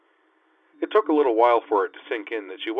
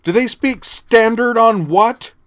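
loud, high, slow call across the hall; carrying shout; 13 dB louder, slower than usual. A narrator's reading. Teen girl shaming the sergeant publicly, slow loud carrying call. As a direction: project it so the whole concourse hears. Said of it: "a voice that carried clearly to the other end of the concourse".

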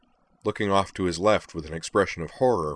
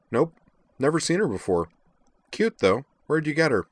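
higher, offhand woman's voice; casual young woman; 9 semitones higher, 4 dB louder than usual. A narrator's reading. Woman looks over a stranger's girl, casual. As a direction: toss it off casually, pitched up.

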